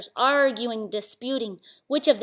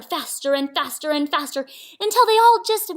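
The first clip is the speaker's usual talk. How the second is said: louder, higher, mounting storytelling; building excitement; 5 semitones higher, 7 dB louder than usual. A narrator's reading. Narrator racing tigers round the tree, mounting excitement. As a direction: push each FASTER further up.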